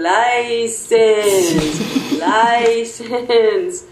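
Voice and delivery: LICENSE stretched out very slowly; over-enunciated broken English. Retold or imitated. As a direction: draw out each syllable of "License" for someone not understanding. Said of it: "in my best broken English for the hearing impaired".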